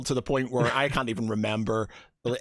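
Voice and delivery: normal talking voice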